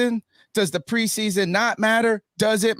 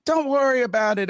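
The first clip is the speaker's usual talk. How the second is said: a lightly higher, dismissive don't worry; mock reassurer; 6 semitones higher than usual. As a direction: brush it off mockingly. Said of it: "you got people going".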